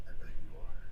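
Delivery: hushed, sultry demonstration. Shown as a demonstration. Game master demonstrating the character's flirty reply, sultry. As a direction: let the voice go husky and suggestive. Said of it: "it's a sultry, like, voice of".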